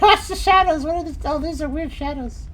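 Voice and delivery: high, excited burst with a laugh; giddy, high-pitched alert. Real, not acted. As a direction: call it out high and amused. Excited by odd game shadows.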